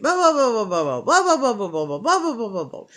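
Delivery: singsong mock blah blah, trailing off; dismissive sing-song blah blah. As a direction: wave her griping away with sing-song blahs. Mocking endless complaints.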